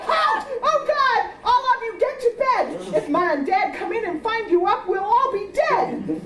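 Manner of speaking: big sister's mock bellow. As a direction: order everyone to bed in a panic. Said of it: "Jane would bellow".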